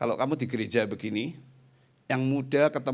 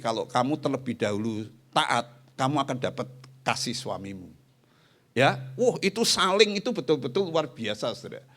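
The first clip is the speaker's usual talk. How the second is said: normal talking voice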